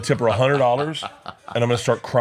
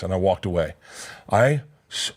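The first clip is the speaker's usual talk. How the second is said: usual speaking voice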